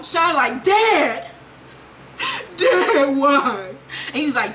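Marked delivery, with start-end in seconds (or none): 0.6-3.7 tearful calls to father; crying out to Dad. Retold or imitated. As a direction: plead with a dying voice. Dying son crying to father.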